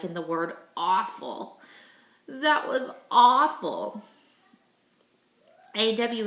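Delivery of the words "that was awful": slow, louder, hammed-up dismay; mock groan. Teacher joking to pupils, slow and louder mock dismay. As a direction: groan it like a bad joke. Teacher jokes after saying awful.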